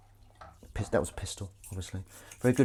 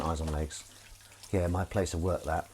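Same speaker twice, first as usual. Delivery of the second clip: usual speaking voice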